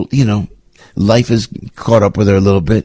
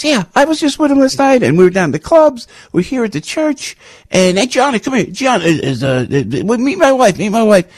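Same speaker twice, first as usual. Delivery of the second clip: much higher, eager voice of a fan; imitating an excited fan; 13 semitones higher than usual. Fan outside church introducing his wife to the host, high excited voice. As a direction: play the eager guy waving his wife over.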